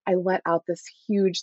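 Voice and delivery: ordinary delivery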